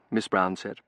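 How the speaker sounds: usual speaking voice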